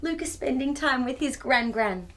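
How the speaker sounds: ordinary delivery, nothing marked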